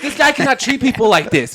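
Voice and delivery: high, loud indignation; pissed-off protest. Retold or imitated. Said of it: "I just got so pissed".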